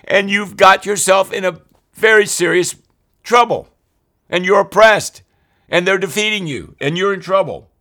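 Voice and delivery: loud, high, fervent preaching; God scolding the Israelites, full-force preaching; preaching rebuke. Acted. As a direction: thunder God's warning at the people.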